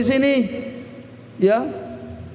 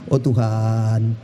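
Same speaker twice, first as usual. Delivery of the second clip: keluhan pelan, jauh lebih rendah; keluhan suami; 8 semitones lower than usual. Retold or imitated. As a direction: mengeluh lambat kepada Tuhan. Husband complaining to God. (suami mengeluh kepada Tuhan soal istrinya, suara rendah)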